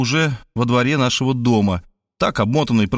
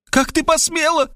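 high shrieking female voice, much higher; shrieking woman. Acted. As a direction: shriek it back at him as Svetlana. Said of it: "завизжала Светлана". (визгливый высокий женский голос)

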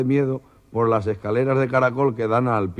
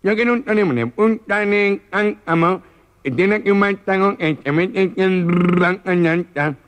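higher, louder, slow garbled nasal order; exasperated gangoso; 7 semitones higher, 4 dB louder, slower than usual. Acted. The gangoso repeats his order to the owner, now exasperated. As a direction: lose patience on the third try. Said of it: "Dígame, señor".

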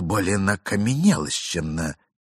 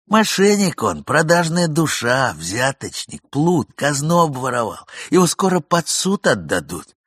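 raised, heated denunciation of a scoundrel; angry tirade; 6 semitones higher, 5 dB louder than usual. Acted. Young man loudly denounces the absent husband, angry. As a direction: spit out the accusations loudly.

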